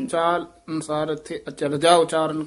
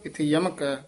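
ordinary talk

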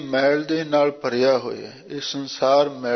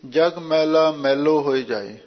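ordinary delivery

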